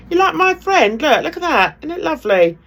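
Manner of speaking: showing it off. Real, not acted. Showing off an object to camera.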